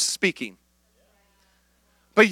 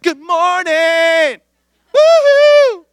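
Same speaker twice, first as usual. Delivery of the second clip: high, slow, sung-out morning greeting; worship band hype; 8 semitones higher, 8 dB louder, much slower than usual. Pretend. Pastor imitates the worship band, high and hyped. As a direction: belt it like a hyped worship leader. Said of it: "You guys didn't know I had range like that".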